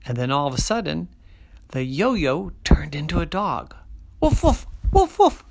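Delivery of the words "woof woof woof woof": high yappy woofs of a small dog; dog barking. Acted. Storyteller barks as the magic puppy. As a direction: bark like a puppy.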